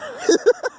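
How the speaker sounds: very high squeaky laugh. Real, not acted. Laughing at sounding like a foreigner, very high. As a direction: laugh at your own silly voice.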